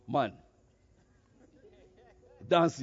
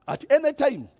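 high, loud, wounded protest; indignant outburst; 9 semitones higher, 7 dB louder than usual. Retold or imitated. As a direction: throw it at the father as an accusation. Older son protests to his father.

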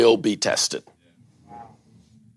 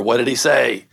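normal talking voice

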